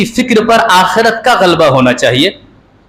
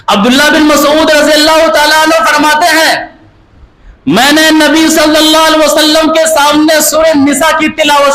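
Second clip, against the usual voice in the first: raised, driving sermon voice, pressing each line home; impassioned preaching; 9 semitones higher than usual. Acted. Preacher scolding listeners for not living by Quran, fervent preaching. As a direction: preach it at full force to the crowd.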